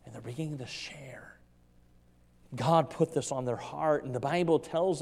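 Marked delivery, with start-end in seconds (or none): 0.1-1.2 hushed, breathless whisper. Acted. Preacher hushing the church as the disciples begin, whispered. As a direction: draw them in with a whisper. Disciples start telling the news.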